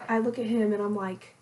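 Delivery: ordinary talk, nothing marked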